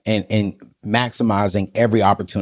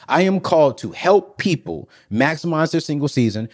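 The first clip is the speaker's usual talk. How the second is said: normal talking voice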